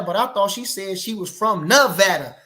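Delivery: ordinary talk, nothing marked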